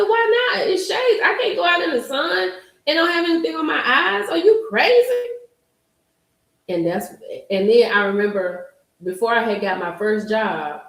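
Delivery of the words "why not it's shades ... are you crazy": indignant retort. Retold or imitated. Telling husband shades are essential, high indignant. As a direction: throw it back at him, incredulous.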